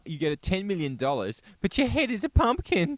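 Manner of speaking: high mock whiny voice. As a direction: parody someone asking. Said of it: "they go".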